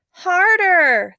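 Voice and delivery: loud, high barked order. Acted. Old woman ordering the women to pull, loud order. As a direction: drive them to pull harder. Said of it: "the Magahaga ordered".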